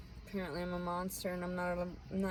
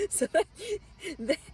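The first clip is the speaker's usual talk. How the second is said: laughing; 11 semitones higher, 4 dB louder than usual. Real, not acted. Laughing while continuing.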